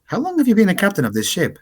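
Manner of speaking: high-pitched questioning character voice; Astra. Acted. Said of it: "she comes up to you and she says".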